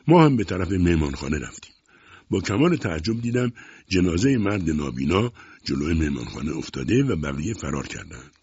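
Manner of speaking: ordinary delivery, nothing marked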